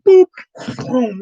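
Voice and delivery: high-pitched boop and boom; cartoon sound effects; imitated flipping noises. Shown as a demonstration. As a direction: voice the tricks with mouth effects. Imitates his flipping show.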